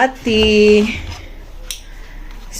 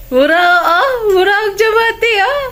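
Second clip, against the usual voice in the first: much higher, fast, whiny squeal; 10 semitones higher, faster than usual. Real, not acted. Squealing baby talk.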